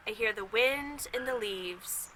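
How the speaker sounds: put-on gentle read-aloud to a child; calm storybook narration; story reading. A narrator's reading. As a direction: read it like a picture book at bedtime. Reads a picture book aloud.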